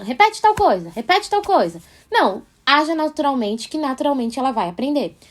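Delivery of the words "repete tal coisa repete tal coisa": pedido repetido em tom agudo de cobrança; insistência imitada. Acted. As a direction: imite um adulto insistindo com a criança. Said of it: "não ficar falando". (cobrança insistente encenada, mais aguda)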